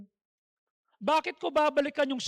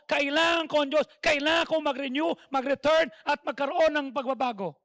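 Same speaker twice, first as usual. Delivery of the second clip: louder, driving sermon build; full-force preaching; 5 dB louder than usual. Acted. Preacher rousing the congregation with testimony, full-force preaching.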